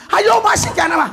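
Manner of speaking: high, loud mock scolding; hammed-up angry foreign shout; mock angry officer. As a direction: snap at him like an angry officer. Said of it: "Dia marah".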